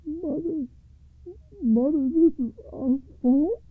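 high, anguished plea; son crying out. A narrator's reading. Oldest son to his wounded mother, high remorseful cry. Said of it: "cried the oldest son".

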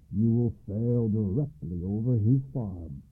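ordinary delivery, nothing marked

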